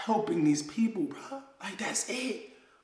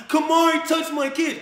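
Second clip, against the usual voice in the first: mocking the accuser; 9 semitones higher, 6 dB louder than usual. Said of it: "You keep on coming up with something".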